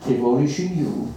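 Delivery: plain reading voice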